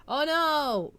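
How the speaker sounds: ordinary delivery, nothing marked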